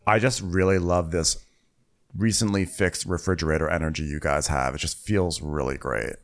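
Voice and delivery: mock languid gushing. Telling the couple she's happy, low languid mock voice. Acting Margot's low voice in reply.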